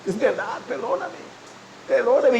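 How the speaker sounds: acted tearful plea, rising high then sinking; tearful pleading. Man repenting to God in his truck, tearful pleading. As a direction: plead for forgiveness as if crying. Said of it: "me puse a llorar en el camión".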